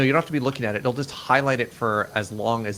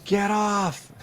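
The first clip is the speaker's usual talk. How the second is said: high, urgent warning cry; mock panicked shout; 9 semitones higher than usual. Player warning riders of the speeding Ferris wheel, mock panic. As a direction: yell out in pretend alarm.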